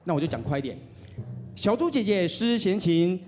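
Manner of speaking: ordinary talk, nothing marked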